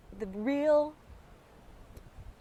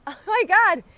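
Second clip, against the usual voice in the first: excited encouragement; 7 semitones higher, 8 dB louder than usual. Pretend. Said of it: "encourage something like".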